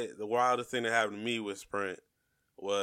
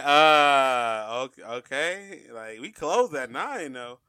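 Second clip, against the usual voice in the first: bemused hesitation; 2 semitones higher, 4 dB louder, much slower than usual. Retold or imitated. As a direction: imitate puzzled staff, drawing it out slowly. Bemused staff answering a feeble demand.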